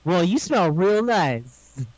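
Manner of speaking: stretched out, slow and smooth; slow flirty drawl. Retold or imitated. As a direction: draw the compliment out slowly. Slowed flirty retelling.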